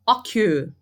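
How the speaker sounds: Occur with a short first syllable and strong final one; stress on last syllable. Shown as a demonstration. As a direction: punch the end of Occur. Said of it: "A sílaba mais forte dele é a última". (Occur com força na última sílaba)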